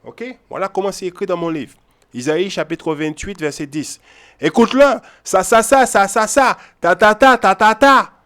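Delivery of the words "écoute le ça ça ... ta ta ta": loud, high mock gabble of empty words; loud scornful nonsense syllables; mocking babble. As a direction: ridicule the chatter with a loud sing-song. Mocking how churches read verses.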